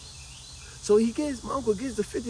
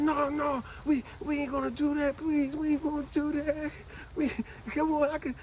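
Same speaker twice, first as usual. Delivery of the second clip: put-on higher, begging childish plea; pleading kid; 10 semitones higher than usual. Boy pleads with his uncle to keep the fifty dollars, higher and begging. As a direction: beg the uncle not to take it.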